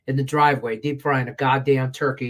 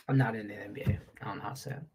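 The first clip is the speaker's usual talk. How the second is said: whispered aside; 7 semitones lower, 11 dB quieter, much faster than usual. Real, not acted. Muttered aside about the NBA.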